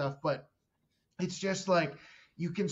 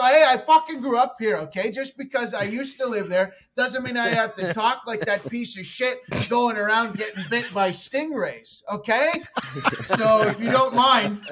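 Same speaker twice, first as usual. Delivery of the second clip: loud, higher hammed-up tirade; mock furious rant; 6 semitones higher, 9 dB louder than usual. Comic yelling back at dinner guests, mock fury. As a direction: play the outraged local, over the top. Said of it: "I go".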